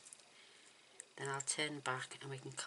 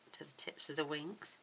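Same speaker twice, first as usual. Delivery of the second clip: usual speaking voice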